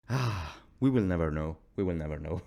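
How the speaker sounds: lightly sighing, low-pitched defeat; understated, hammed-up resignation; mock resignation. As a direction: let out a sigh and give up. Sighing resignation after the rant.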